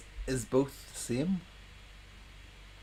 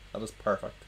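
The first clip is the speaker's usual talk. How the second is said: usual speaking voice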